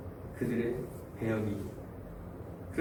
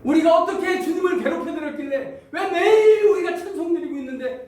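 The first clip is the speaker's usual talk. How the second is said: high, loud, half-laughing complaint; indignant protest; 12 semitones higher, 8 dB louder than usual. Acted. Israelites arguing with God, loud laughing indignation. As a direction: push back in mock outrage. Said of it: "이렇게 따집니다".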